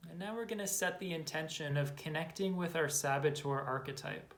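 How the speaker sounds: calm, unhurried meditation guidance with long pauses; guided meditation. Acted. Guide to listeners closing their eyes to follow belly breaths, calm meditation voice. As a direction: lead them gently into the breath, leaving space after each phrase.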